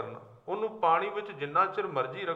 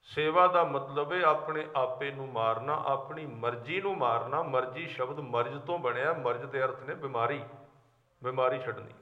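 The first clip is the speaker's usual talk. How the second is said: ordinary talk, nothing marked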